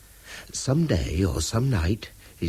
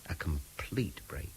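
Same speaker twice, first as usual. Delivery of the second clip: whispered; 4 semitones lower, 13 dB quieter, slower than usual. Acted. Repeats his wish softly.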